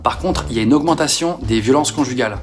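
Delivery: ordinary delivery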